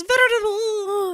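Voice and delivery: hammed-up horrified cry; fainting mid-word. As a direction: wail the word and trail into a faint. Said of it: "she can't even get the word out".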